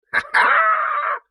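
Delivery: falsetto cackle, falling at the end; high squealing laugh. Real, not acted. Host joking at sign-off about the accent, squealing laugh.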